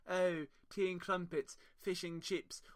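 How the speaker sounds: mock posh English. Englishman mocking how Americans hear his English accent. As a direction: lightly send up the tea-and-crumpets English accent. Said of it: "Everyone thinks English accents are like".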